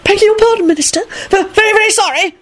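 high, stammering apology; frenetic babble. A narrator's reading. Flustered warlocks apologize to the Minister, babbling frenetically. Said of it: "the warlocks babbled frenetically".

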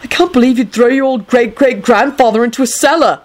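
high, outraged voice; heated. A narrator's reading. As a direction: scold in high-pitched outrage. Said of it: "Neville said heatedly".

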